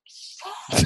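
breathless whispered Shit; stunned whisper to herself; whispered curse. Retold or imitated. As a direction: hush it like you can't believe it. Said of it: "And I was like".

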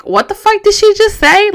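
high, loud imitated incredulous question; retold bafflement.

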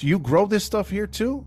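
mock a scandalized neighbor's challenge; lightly acted indignation, pitch climbing; indignant questioning. Retold neighbor's indignant confrontation.

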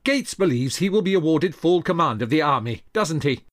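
heated, high and forceful; indignant venting. Acted. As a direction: press a friend for confirmation, incensed. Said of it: "as Hamilton vented".

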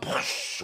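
unvoiced little "push" burst; soft breathy pop sound; whispered puff. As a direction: puff out a tiny explosion. Box explosion sound.

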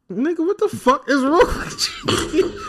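retold disbelief, high and drawn out; high, slow, breaking into a laugh; exasperated retort. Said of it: "I'm like".

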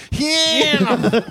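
"Ham" high, vowel stretched; mock long A. Shown as a demonstration. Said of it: "I don't know that A".

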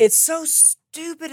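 high, loud and slow, fed up; exasperated outburst. Real, not acted. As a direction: spit it out, totally fed up with the internet. Echoing exasperation about bad internet.

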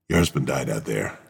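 low, soft put-on American accent; a hint of a grave American officer at the door; mock American accent. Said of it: "put an American accent on".

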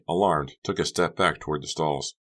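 plain reading voice

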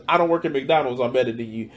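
mocking snob quote. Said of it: "flex it on people because".

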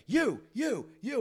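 high accusing calls; single him out of the crowd; imitating Zach calling out a fan; pointing out. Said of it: "Zach's just like".

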